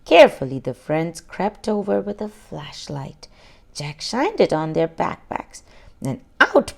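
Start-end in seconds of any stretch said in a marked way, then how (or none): none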